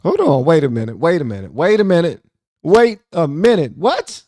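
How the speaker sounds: repeated protest climbing high and loud; stunned disbelief. Real, not acted. Host stopping the clip he just heard, stunned. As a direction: halt everything, rising to a high, baffled cry.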